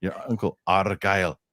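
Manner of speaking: put-on half-serious Scottish accent, as in Braveheart; mock Scottish accent. Host repeating the joke to his cohost in Scottish accent. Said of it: "First part was Arnold".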